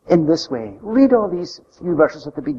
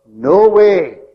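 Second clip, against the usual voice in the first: loud, emphatic mock refusal; defiant refusal; 3 semitones higher, 7 dB louder than usual. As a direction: imitate a flat-out refusal, loudly. Acting out the sons' defiant refusal.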